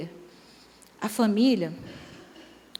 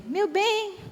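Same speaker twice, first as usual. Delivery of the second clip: put-on much higher, doting little old lady voice; sweet old lady; 8 semitones higher than usual. Elderly wife sweetly asks her husband for old affection, high doting voice. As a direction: coo it like a frail granny missing old romance. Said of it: "a velhinha fala assim pra ele".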